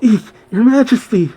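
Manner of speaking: high-pitched Eek, Your Majesty; a servant woman's shocked cry, lightly voiced; mock cry out. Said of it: "the woman cries out".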